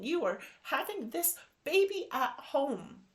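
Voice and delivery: high, excited retelling; overjoyed, near tears; emotional. Real, not acted. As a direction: let the joy lift the voice way up. Retold joyful exchange, voice high.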